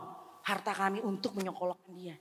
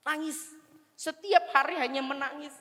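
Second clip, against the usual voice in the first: high, pained voice; sorrowful, pitch lifted; tearful recollection; 7 semitones higher than usual. Retold or imitated. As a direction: tell it as if near tears. Recalling daily weeping before God.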